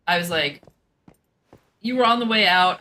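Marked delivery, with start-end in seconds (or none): 1.8-2.8 high, loud burst at the start of the rant; retold yell. To her cheating fiancé, a loud retold yell. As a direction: throws it at him like a final verdict. Breakup fight retold, yelling at him.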